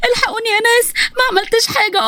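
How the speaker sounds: high, tearful cry for help. Acted. The beaten daughter calls the neighbours for help, high and tearful. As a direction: call out for rescue through tears.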